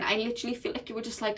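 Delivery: usual speaking voice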